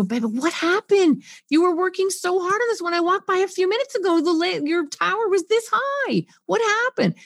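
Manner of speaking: high, caring, urgent concern; urgent empathy. Shown as a demonstration. Parent comforting a child whose tower fell, urgent caring. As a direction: mirror the child's urgency tenderly. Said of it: "Can you hear my affect?".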